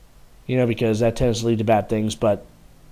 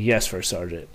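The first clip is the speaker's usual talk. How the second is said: lower, softer sheepish answer; meek reply; 6 semitones lower, 6 dB quieter than usual. Retold or imitated. Corporal answering the angry first sergeant, sheepish and soft. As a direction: shrink under the scolding.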